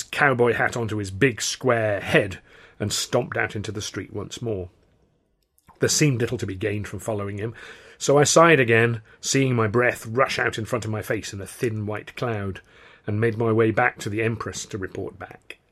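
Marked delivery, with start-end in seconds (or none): none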